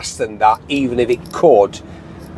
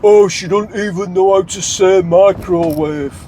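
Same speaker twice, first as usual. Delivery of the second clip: higher-pitched mocking idiot; 5 semitones higher than usual. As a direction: imitate a clueless critic, pitched up. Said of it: "Yeah, in that voice".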